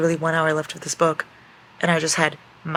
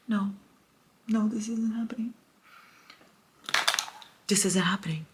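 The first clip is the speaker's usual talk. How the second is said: hushed, higher, stricken whisper; whispered despair; 6 semitones higher, 3 dB quieter than usual. Real, not acted. Reader whispers despair to viewers at the breakup. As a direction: whisper it in denial.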